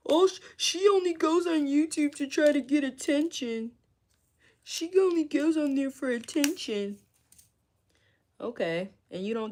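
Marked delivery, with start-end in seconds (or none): 0.1-7.0 high, sneering imitation of haters; mocking haters. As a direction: mimic the haters in a high whiny voice. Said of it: "They're like".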